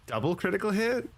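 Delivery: high rising double-take; surprised question. Real, not acted. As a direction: pitch climbing high in surprise. Reacting to unexpected game hit.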